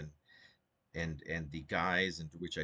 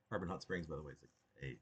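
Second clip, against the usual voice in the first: ordinary delivery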